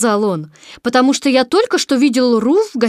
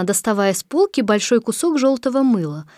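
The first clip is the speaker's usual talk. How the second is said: ordinary delivery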